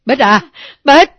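put-on loud, frantic calls to a hurt child; urgent, very loud concern; alarmed calling. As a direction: call out in panic. Grandmother alarmed for injured grandchild.

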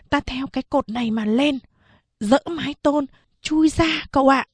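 put-on high, earnest child voice rising; a boy coaxing his young master to escape; eager boy servant. Servant boy urging escape, high and eager.

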